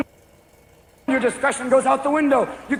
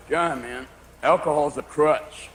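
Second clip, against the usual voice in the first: stoner friend; 7 semitones lower, 3 dB quieter, slower than usual. Retold or imitated. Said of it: "your friends who smoke marijuana going".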